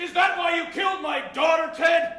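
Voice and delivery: angry shout. Acted. Accusing friend of murder.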